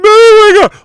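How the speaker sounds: a high, loud, stunned cry; overwhelmed oh my God. Retold or imitated. Recalling crying at the movie, a high loud stunned exclamation. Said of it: "I went".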